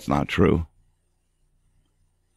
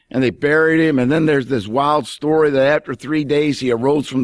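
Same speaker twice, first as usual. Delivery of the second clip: a mild, raised sneer at the followers of Christ; mocking scoff; 6 semitones higher than usual. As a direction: scoff at them lightly, like a bystander. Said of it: "It was actually a term of derision".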